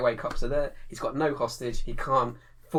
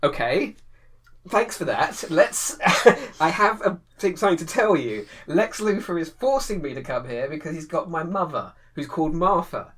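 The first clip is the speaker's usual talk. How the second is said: mock Superman explaining; 5 semitones higher, 7 dB louder than usual. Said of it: "and go".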